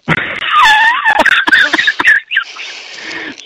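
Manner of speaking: high shrieking laugh. Real, not acted. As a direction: burst out laughing at the memory. Laughing after the eerie line.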